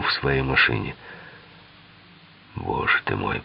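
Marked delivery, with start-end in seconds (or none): 2.6-3.4 low, hushed hiss of exasperation; hissed exclamation. A narrator's reading. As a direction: hiss it low and under the breath. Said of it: "прошипел Харди".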